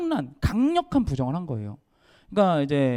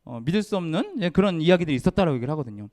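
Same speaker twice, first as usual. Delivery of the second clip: ordinary talk, nothing marked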